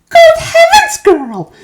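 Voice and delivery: very high, loud alarmed shriek; panicked mother shrieking. A narrator's reading. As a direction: shriek at your daughter in horror. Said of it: "shrieked Missus Beauregard".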